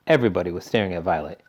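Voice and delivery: plain reading voice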